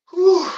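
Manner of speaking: high loud whew of strain; effort exhale. Real, not acted. Straining through the set, a loud whew. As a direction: blow it out hard after the rep.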